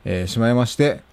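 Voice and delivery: ordinary talk, nothing marked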